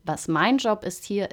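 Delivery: ordinary delivery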